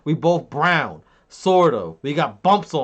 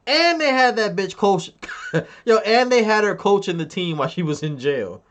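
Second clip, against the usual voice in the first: high, loud, through laughter; real laughing; 6 semitones higher, 3 dB louder than usual. Real, not acted. Streamer amazed she was coaching from jail, laughing. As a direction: crack up as you tell it. Laughing at his own joke.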